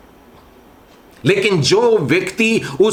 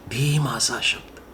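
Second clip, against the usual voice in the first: hushed still voice; 5 semitones lower, 9 dB quieter than usual. Acted. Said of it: "दबा हुआ धीमा शब्द".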